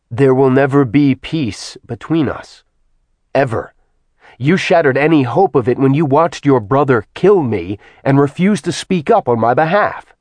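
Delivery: bury any hope of peace with rage; furious man accusing the goddess; cold fury. Acted. Acheron furious at Artemis.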